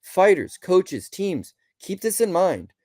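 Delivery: normal talking voice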